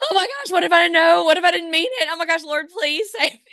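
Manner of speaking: put-on high, fast, frantic retelling of a scared kid; mock childhood panic. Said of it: "I was like".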